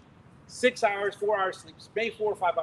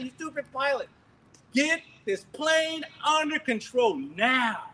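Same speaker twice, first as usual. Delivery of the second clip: higher, slow demanding command; commanding roar; 6 semitones higher, slower than usual. Retold or imitated. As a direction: order the pilot to take control, slowly. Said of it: "my roar".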